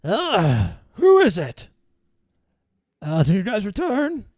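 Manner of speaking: high, grumpy voice behind the door; creature voice from inside. Acted. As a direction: call through the door, irritated and high. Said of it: "a voice from inside go".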